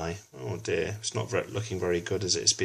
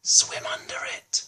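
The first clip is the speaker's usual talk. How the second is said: whisper. A narrator's reading. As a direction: breathe it to the fish. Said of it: "whispered Finley".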